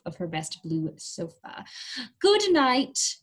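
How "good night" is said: higher, louder, sweet good night; kind good night. Acted. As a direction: tuck him in with a gentle farewell. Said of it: "she said".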